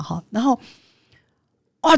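usual speaking voice

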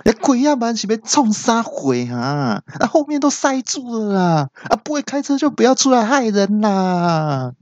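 put-on very high squeaky toddler telling off; baby voice scolding. Toddler in toy car scolds driver, squeaky baby voice. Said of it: "小小的奶音来骂我说".

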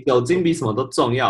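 normal talking voice

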